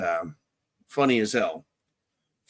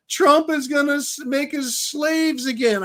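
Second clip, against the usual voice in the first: high-pitched mock wail; mock crying; 9 semitones higher than usual. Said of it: "crying and crying, they're like".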